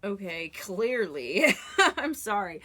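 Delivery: loud, real burst of laughter through the words; laughing. Real, not acted. Reader apologizing to Sag over the cards, laughing. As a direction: crack up loudly, then apologize.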